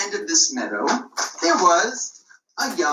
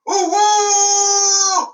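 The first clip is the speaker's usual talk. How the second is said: high loud cry of alarm; 13 semitones higher, 6 dB louder than usual. Shown as a demonstration. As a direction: shout the warning for everyone. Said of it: "Let me hear you do that".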